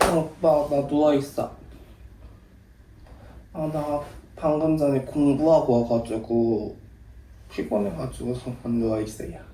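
느릿하고 작게, 얌전한 말투; 오빠에게 피곤한 척 나긋나긋하게; 내숭 전화 목소리. Real, not acted. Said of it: "누나 목소리 왜 그래?". (평소와 다른 조용한 내숭 목소리)

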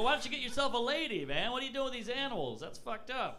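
half-laughing exasperation at him; incredulous. Retold or imitated. Comic scolds goat guy, incredulous.

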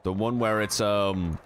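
ordinary talk, nothing marked

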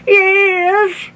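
very high, drawn-out wail; hammed-up weepy old man; tearful Grandpa. A narrator's reading. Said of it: "tears in his eyes".

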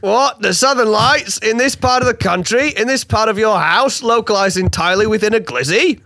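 panicked boss demanding answers; alarmed outburst. Acted. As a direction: jump up in pitch, startled and accusing. Superintendent alarmed at the fire.

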